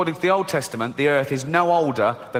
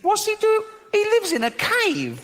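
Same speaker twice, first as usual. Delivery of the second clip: incredulous squeak. Real, not acted. Incredulous at Noah in cave.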